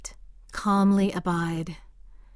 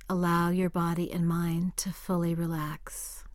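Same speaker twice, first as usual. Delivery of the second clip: breathy whispered instruction; soft spa whisper; 4 dB quieter than usual. Acted. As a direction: hush it like a spa guide. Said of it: "relaxation instruction".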